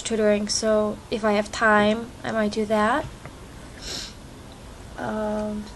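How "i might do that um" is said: usual speaking voice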